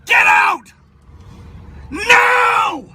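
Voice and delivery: sharp screamed refusal; loud sharp voice. Real, not acted. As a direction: scream a hard no. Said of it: "You have no right to scream at me".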